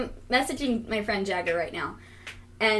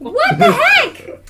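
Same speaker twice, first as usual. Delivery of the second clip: high, drawn-out outraged yell; loud disbelief; 13 dB louder, much slower than usual. Real, not acted. Friend reacts to the locked Twitter account, loudly incredulous. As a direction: cry out in laughing disbelief.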